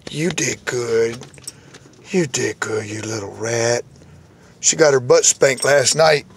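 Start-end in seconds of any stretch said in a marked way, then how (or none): 0.1-3.8 low, soft words to a pet; soft praise. Real, not acted. Praising the dog afterwards.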